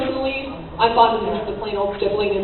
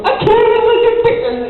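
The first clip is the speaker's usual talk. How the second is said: mock [name]; 10 semitones higher, 5 dB louder than usual. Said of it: "You just go".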